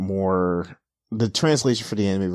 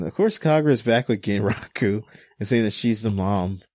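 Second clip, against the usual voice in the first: ordinary talk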